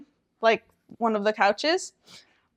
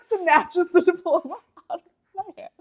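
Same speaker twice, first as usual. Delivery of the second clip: normal talking voice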